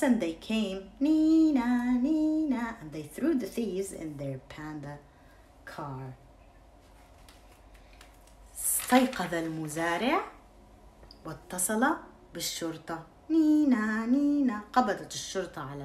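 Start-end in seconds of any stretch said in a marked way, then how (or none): 1.0-2.8 siren imitation. 13.3-14.6 siren imitation.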